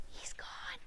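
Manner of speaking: high, soft breathy whisper; relieved whisper. Acted. Relieved sheep after wolf leaves.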